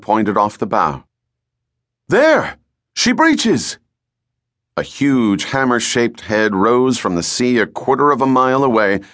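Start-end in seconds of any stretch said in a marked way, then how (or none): 2.1-3.8 excited lookout cry. Acted. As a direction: point and yell it out. Lookout calling from masthead.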